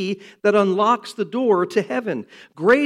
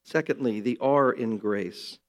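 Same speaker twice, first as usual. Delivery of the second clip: ordinary talk, nothing marked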